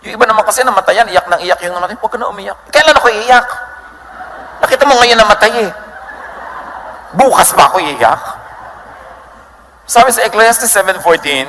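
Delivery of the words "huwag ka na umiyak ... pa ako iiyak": mock grieving relative. Mourner mocking comforters at a wake.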